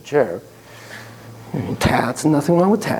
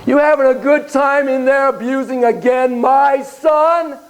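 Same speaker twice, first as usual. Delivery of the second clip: high, drawn-out accusing yell; acted enraged bellow; 8 semitones higher, slower than usual. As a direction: yell the accusation across the room. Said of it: "And he yells".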